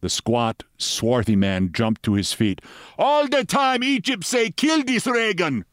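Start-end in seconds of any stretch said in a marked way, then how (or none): 3.0-5.6 high, excitable foreign henchman; high-pitched, broken-English outburst; mock shouting. A narrator's reading. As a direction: shout in a high voice without real volume. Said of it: "he shouted".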